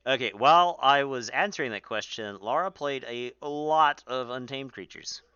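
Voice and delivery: loud, excited casting voice; projecting over the crowd; speaking too loudly. Real, not acted. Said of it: "I'm actually speaking too loudly".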